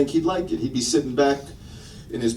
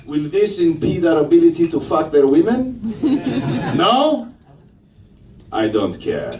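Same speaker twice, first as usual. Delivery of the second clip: put-on Greek accent. Comedian as Greeks reacting to Trump, Greek accent. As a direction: ask earnestly, then shrug it off in a Greek accent. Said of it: "The Greeks are sitting back going".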